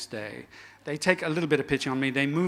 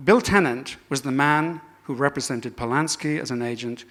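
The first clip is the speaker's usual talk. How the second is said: ordinary talk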